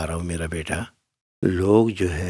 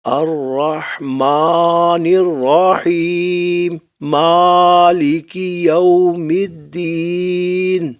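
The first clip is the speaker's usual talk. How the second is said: much higher and louder, very slow, straining; loud strained recitation; 8 semitones higher, 6 dB louder, much slower than usual. Shown as a demonstration. Teacher showing the wrong loud recitation, strained and slow. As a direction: push the voice out too loud and drag it. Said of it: "اب اگر میں آواز زیادہ نکالوں".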